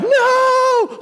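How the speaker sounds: squeaky shocked No; shocked shout. Retold or imitated. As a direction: cry out No in disbelief, laughing. Rip Curl guy hears he's switching.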